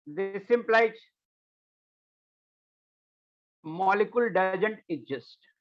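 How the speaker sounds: very slow, measured dictation with long pauses. Real, not acted. Teacher dictating a bond order rule to class, slow dictation. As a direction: dictate it phrase by phrase for note-taking.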